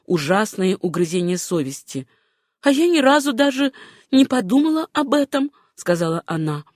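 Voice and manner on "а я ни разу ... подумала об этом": lightly voiced woman, pitched high; Susanna, remorseful. A narrator's reading. Susanna admitting to John her lapse, remorseful. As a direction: lift the voice in self-reproach.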